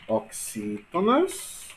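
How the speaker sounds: very slow, syllables drawn out; slow dictation. Shown as a demonstration. Teacher listing word classes for note-taking, slow dictation. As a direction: stretch each term out as if writing along. Dictating the categories while writing.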